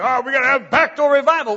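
mock self-satisfied church voice, loud; mocking Baptists. Preacher mocking Baptists who lose members out the back, mock smug voice. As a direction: lightly parody a smug Baptist. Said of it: "All these Baptists".

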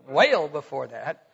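slow Southern drawl on well, said as weyl; Southern accent demo. Shown as a demonstration. Preacher replaying his Southern slip for the congregation. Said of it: "That's a good Southern accent there".

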